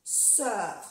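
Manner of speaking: "Sœur" con œ redondeada como una E francesa; pronunciación francesa. Shown as a demonstration. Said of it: "Como una E". ("Sœur" con la E francesa redondeada)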